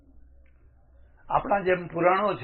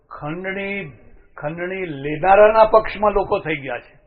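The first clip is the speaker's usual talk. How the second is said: emphatic declaration; 3 semitones higher, 7 dB louder than usual. Real, not acted. Outraged about extortion.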